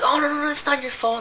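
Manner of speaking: imitating guests in a high, sugary voice; a lightly mocking sweet reassurance. Guests quoted, much higher.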